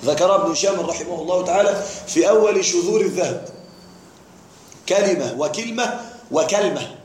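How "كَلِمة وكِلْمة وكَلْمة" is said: كلمة وكلمة وكلمة as kalima, wa-kilma, wa-kalma; vary each كلمة: kalima, then kilma, then kalma; three dialect forms. Shown as a demonstration. Teacher recaps the three variants, saying كلمة as kalima, kilma, kalma. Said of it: "هذه ثلاث لغات".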